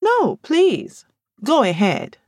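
high mock-polite invitation; sarcastic bait; goading. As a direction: needle her with fake courtesy. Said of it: "Amaka goaded".

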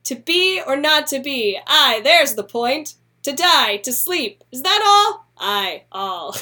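mock-grand reading of the garbled speech; butchered Hamlet. Shown as a demonstration. Mocking the bad quarto to a co-host, hammed up. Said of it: "it said".

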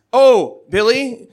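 usual speaking voice

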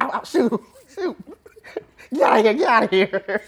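mock shooing yell. Shooing animals off the ark.

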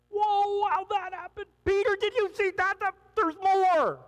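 high, astonished cry of wonder; amazed disciple. Acted. A disciple in the boat calls to Peter, amazed. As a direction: gasp it out like you can't believe your eyes. Disciples watching the food multiply.

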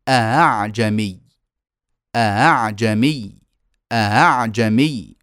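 أعجمي drawn slowly, second hamza only half-closed; softened second hamza. Shown as a demonstration. Teacher showing tas-hil to students, second hamza softened. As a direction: press the throat without shutting it on أعجمي. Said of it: "وإنما سنضغط عليها، فأقول".

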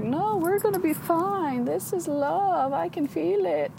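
mock your younger naive hope; naive dreamy self. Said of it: "being like".

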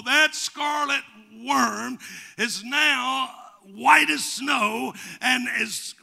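loud, high, ringing proclamation; full-force preaching. Real, not acted. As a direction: preach the verse out with slow, heavy emphasis.

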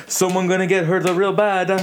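stern nonna voice. Retold or imitated. Nonna with wooden spoon.

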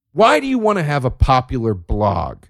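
ordinary delivery

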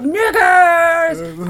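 high, long mock holler; yelled out. Imitating a man yelling as they went down the street, yelling. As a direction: yell it down the street. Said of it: "he just yelled out".

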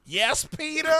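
high-pitched put-on accent from The Help; different mock accent. Aunt May answering Peter, high mock accent. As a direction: squeak it in a hammed-up movie accent. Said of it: "that was a different accent".

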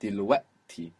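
"دلوقتي" with the throat closed at ق; glottal stop. Shown as a demonstration. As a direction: catch the throat before the last syllable of "دلوقتي". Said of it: "You are tightening the throat".

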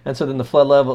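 ordinary talk

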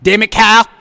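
loud, whiny cartoon kid yell; hammed-up Cartman outburst. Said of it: "in the Cartman voice".

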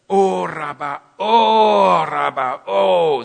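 loud groaning cry. Shown as a demonstration. Preacher shows the congregation the groaning, loud groaning cries. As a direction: cry out groaning as if moved in spirit.

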